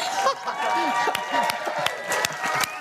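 long voiceless burst of laughter; long real laugh. Real, not acted. Preacher seeing so many young people come up, real laughing. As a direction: laugh it out helplessly after the surprise.

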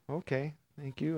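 soft, subdued thank you; quiet thanks. Retold or imitated. Mother quietly thanking the preacher, soft and calm. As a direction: hush it, calm and reserved. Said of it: "They're pretty quiet people".

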